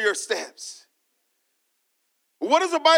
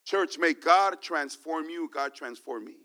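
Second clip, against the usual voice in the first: low, hushed prayerful wish; soft blessing; 5 semitones lower, 5 dB quieter than usual. Acted. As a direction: bless them gently, almost a prayer.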